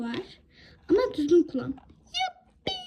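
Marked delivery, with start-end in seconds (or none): 1.7-2.7 very high squeal of delight; thrilled toy daughter cheering; joyful squeal. Acted. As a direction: squeal like a little girl. Child gets a toy.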